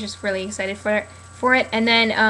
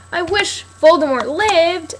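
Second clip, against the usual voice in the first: slow, higher mock-whiny wish; mock whiny fan; 7 semitones higher, 6 dB louder, slower than usual. Vlogger mocking fans who want a different ending, whining. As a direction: whine it like a disappointed fan. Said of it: "and I don't go".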